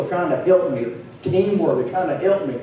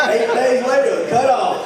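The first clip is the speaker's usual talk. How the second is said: laughing; 8 semitones higher than usual. Real, not acted. Punchline through laughter.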